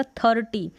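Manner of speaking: "थर्टी" with the r completely pronounced; full r. Shown as a demonstration. Teacher showing the incorrect result, "थर्टी" with full r. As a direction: give "थर्टी" a whole, sounded r. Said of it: "पूरा करेंगे तो इस तरीके से".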